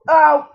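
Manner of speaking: real startled "ow"; pained yelp. Real, not acted. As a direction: cry out sharply at a sudden hit. Hurt in the game.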